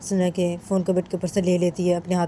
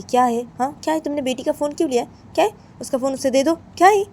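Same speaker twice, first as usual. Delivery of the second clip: raised, higher-pitched challenge; indignant father; 8 semitones higher, 6 dB louder than usual. Acted. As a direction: confront her, sharpening to a loud last demand. Father confronts mother over phone. (नाराज़ होकर सवाल करता पिता)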